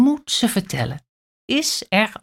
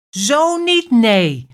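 high, louder indignant no; angry; 5 semitones higher, 5 dB louder, slower than usual. A narrator's reading. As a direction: snap it off in anger. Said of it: "denkt Kleine Aap boos". (Kleine Aap denkt boos, hoog en luider)

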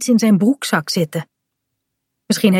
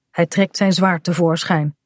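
ordinary delivery

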